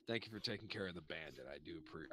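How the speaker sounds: low, soft ogre tavern keeper; low and hushed, like a gentle giant introducing himself; Grunt, the nighttime tavern keep, low and soft; low ogre barkeep. Acted.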